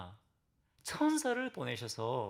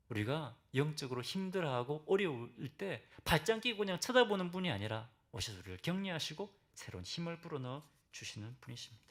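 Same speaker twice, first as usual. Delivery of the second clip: ordinary talk, nothing marked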